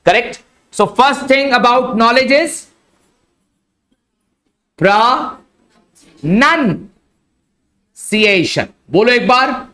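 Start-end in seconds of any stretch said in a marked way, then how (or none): 0.7-8.6 "pronunciation" stretched very slowly, first syllable as ra; careful pronunciation. Shown as a demonstration. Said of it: "इसको प्रो नहीं बोलना है". ("pronunciation" बहुत खींच कर)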